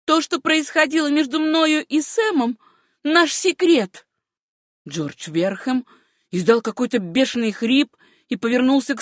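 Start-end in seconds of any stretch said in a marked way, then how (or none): 0.1-4.1 put-on high, resolute girl's voice; firm answer. A narrator's reading. Niece defies her uncle over Sam, high firm reply. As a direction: state it firmly, pitched high. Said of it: "ответила твёрдым голосом".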